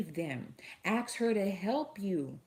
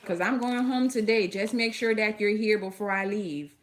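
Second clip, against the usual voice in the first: louder and higher, calling after him down the hall; 4 semitones higher, 5 dB louder than usual. Real, not acted. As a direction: raise your voice to reach someone leaving the room. Calling after man leaving.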